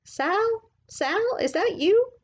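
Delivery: put-on high, far-off old lady's call; old woman calling out. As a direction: call out like an old neighbor woman from her porch. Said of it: "a voice called out".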